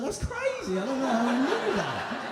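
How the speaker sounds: very high squeaky surprise, laughing; mock shock. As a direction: play it stunned and tickled. Shocked reply to mum.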